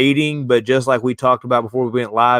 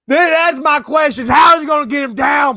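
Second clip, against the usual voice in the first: mock yell; 6 dB louder than usual. Mock yelling question at Brady.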